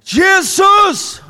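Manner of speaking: a sudden, very high, loud cry of "Jesus!"; crying out a name. Retold or imitated. Dying man in a hotel room cries out Jesus, desperate shout. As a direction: call out the name as if your life depends on it. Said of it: "så ropar jag ut namnet".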